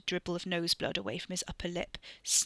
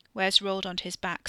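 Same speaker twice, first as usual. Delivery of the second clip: plain reading voice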